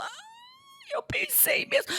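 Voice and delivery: high broken cry into a whisper; whimpering. Pretend. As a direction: let it break into a sob. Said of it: "E você fala".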